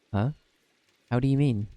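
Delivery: ordinary talk, nothing marked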